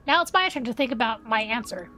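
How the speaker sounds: usual speaking voice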